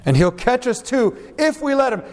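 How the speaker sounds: normal talking voice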